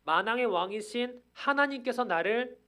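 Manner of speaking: high, loud preacher's proclamation; ringing declaration in the apostle's words; Paul's bold declaration. Acted. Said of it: "오늘 바울이 말하는 바는".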